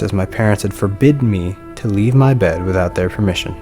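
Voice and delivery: usual speaking voice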